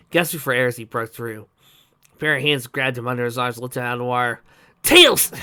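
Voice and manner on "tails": high, loud cry of a friend's name; sudden loud, high-pitched exclamation; exclaiming. A narrator's reading. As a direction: call out loudly in glad surprise. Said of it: "exclaimed Sonic".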